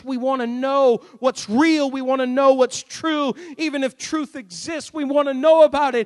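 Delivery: loud, climbing proclamation; rising preaching. Acted. Preacher proclaiming Jesus's words to the congregation, loud. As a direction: build it up loud. Climax of sermon.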